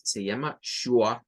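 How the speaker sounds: ordinary talk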